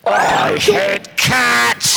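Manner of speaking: drawn-out, very slow yell; angry yell. Acted. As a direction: bellow it out, stretching every word. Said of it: "Don't yell at me".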